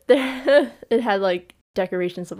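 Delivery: ordinary delivery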